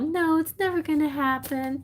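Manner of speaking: high, whiny mock despair; hammed-up hopeless wail; mock crying. Cinderella refusing the mice's comfort, mock crying. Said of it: "starts crying".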